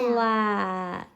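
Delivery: "Flat" stretched slowly; drawn-out word. Shown as a demonstration. Teacher blending a word for a child, drawn out. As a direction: drag the sounds of "Flat" into one long word. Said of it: "drag out each sound".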